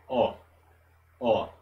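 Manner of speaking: "Ó" with a wide open O; open O sound. Shown as a demonstration. Teacher to students, open O demo. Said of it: "com o O um pouco mais aberto".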